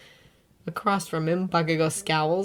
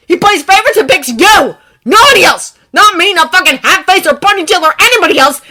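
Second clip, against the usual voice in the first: louder, much higher furious rant; raging tirade; 13 semitones higher, 16 dB louder than usual. Acted. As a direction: rage through it.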